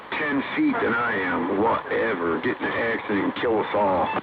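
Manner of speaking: usual speaking voice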